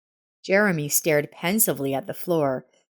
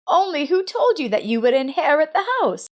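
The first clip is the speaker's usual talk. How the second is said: a hint of a hurt older mother, pitched up; mildly indignant mother; 11 semitones higher, 4 dB louder than usual. A narrator's reading. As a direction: challenge her daughter's assumption. Frida confronting daughter.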